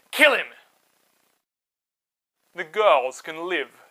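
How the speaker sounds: cruel king's order. Acted. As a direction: bark the order at the midwives. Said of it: "The king of Egypt said".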